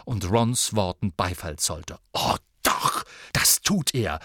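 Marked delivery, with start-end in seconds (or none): 2.1-4.2 "Oh doch" hissed without voice; Ginny rounding on Harry, hissing; hissed retort. Acted. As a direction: spit the words at Harry. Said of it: "fuhr sie nun Harry an".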